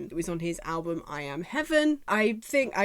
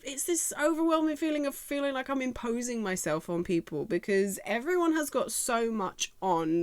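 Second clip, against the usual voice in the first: ordinary delivery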